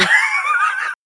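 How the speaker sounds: high shrieking laugh; whooping laugh. Real, not acted. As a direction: let out a loud shrieking laugh. Laughs after the shout.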